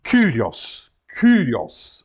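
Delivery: "Kyrios" with a rounded Greek u; Greek U sound. Shown as a demonstration. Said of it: "Reparem, U, não temos". ("Kyrios" com u grego)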